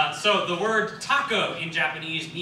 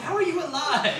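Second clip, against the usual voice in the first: baffled onlookers; 9 semitones higher than usual. Retold or imitated. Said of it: "Everyone's like".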